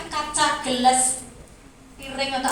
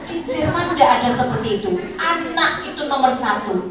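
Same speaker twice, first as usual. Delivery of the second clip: usual speaking voice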